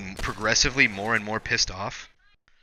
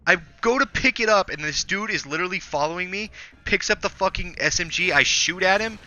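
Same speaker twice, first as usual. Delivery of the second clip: higher, agitated ranting; heated rant; 8 semitones higher than usual. Real, not acted. Gamer ranting to friend about dying: agitated rant. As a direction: vent the frustration fast and hot.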